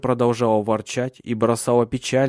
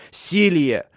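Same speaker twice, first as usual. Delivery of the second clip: higher, louder call to his wife; desperate cry; 6 semitones higher, 4 dB louder than usual. A narrator's reading. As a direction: cry out her name in despair. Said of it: "вскричал он в отчаянии". (отчаянный возглас)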